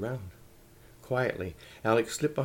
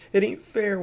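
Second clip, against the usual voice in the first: high, loud wail of unfairness; 10 semitones higher, 9 dB louder than usual. A narrator's reading. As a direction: wail it out in protest. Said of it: "wailed Elsie".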